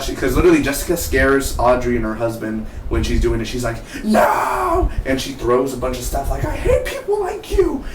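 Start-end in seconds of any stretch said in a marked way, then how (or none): 4.0-4.9 high mock yell of no; high-pitched imitated scream. Jessica's outburst reenacted. 6.4-7.8 high-pitched imitated rage; shrieking imitated fury; mock angry shriek. Jessica's rage reenacted.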